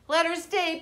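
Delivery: high pleading bear voices; begging bears. A narrator's reading. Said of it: "begged the bears".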